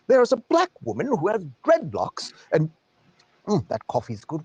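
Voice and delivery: mock upper-class British accent, swooping up and down in pitch; British aristocracy accent. Coffee-drinking liberal reads the paper to darling, mock British aristocracy accent. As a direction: play a scandalized British aristocrat, theatrically aghast. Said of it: "like, a British aristocracy accent".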